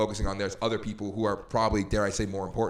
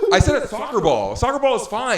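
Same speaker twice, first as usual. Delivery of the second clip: laughing insistence; 11 semitones higher, 9 dB louder than usual. Real, not acted.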